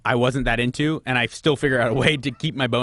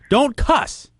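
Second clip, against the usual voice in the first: sharp, loud, higher telling-off; stern scolding; 10 semitones higher, 4 dB louder than usual. Real, not acted. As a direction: snap at him to stop swearing. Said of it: "I gotta yell at him first".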